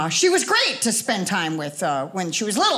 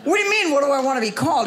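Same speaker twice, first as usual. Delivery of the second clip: indignant; 4 semitones higher, much faster than usual. Retold or imitated. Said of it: "I go".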